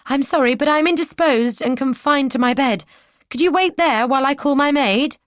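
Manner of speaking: a lady calling through the door; heroine's voice. Acted. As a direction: put on a delicate indisposed lady. Heroine answers soldier.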